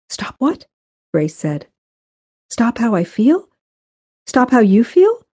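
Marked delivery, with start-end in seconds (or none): 0.1-0.7 high, rising question; questioning challenge. A narrator's reading. Grace questions Benton's order to stop, high and challenging. 2.5-5.3 challenging questions. A narrator's reading. Grace challenges Benton about feelings, high and rising.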